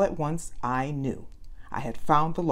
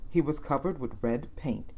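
ordinary delivery, nothing marked

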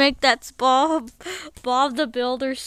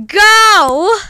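shout; 6 semitones higher, 12 dB louder than usual. Acted. Countdown to the big scream.

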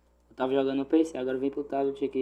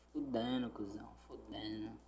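muttered curse, much softer; cursing under breath; 5 semitones lower, 14 dB quieter than usual. Real, not acted. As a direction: grumble it under your breath. Irritado, fala baixinho. (xingando baixinho)

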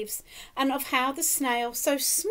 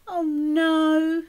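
a high, loud cry of dismay; 4 semitones higher, 6 dB louder than usual. Acted. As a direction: gasp it out as if the news is awful. Dismay at beached whale.

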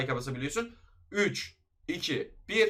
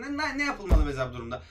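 ordinary delivery

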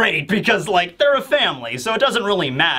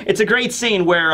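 plain reading voice

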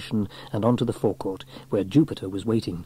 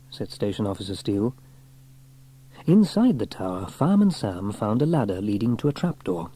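usual speaking voice

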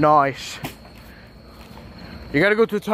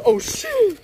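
surprised. Real, not acted. Startled during ride.

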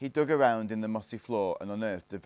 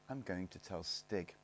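slow, soft, level statement; calm; 9 dB quieter than usual. A narrator's reading. Barney answers the jeering gang, calmly. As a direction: say it quietly and unhurried. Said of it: "he said calmly".

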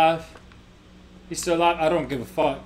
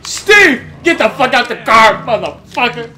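loud desperate pleading; frantic shouting under attack; being chased; 13 dB louder, slower than usual. Real, not acted.

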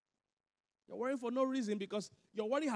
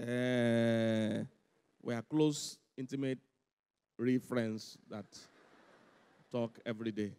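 lower, slow, coy drawl; mock girlfriend voice; 10 semitones lower, slower than usual. The real man is told off, lightly voiced coy girl. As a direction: play the girl brushing off her true match. Girl explains other relationship.

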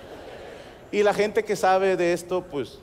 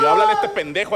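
much higher, louder pained yell; 6 dB louder, faster than usual. Retold or imitated. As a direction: cry out from the hurt back. Adolorido pide fisioterapeuta. (grito adolorido y agudo)

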